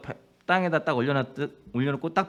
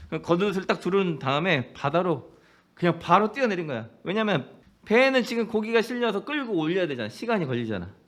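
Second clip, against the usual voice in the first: ordinary talk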